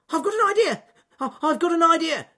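very high, loud excited shriek; Ernest Fluffy Rabbit shriek. Acted. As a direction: shriek it out, bursting with the idea. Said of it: "shrieked Ernest Fluffy Rabbit".

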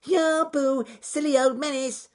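very high, loud rabbit jeer; squeaky taunt. Acted. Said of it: "squeaked Ernest Fluffy Rabbit".

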